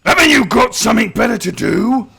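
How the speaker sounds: gruff orc. Acted. Agzok scolding nearby orcs, gruff orc voice. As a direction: glower and send them off. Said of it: "he looks at and says".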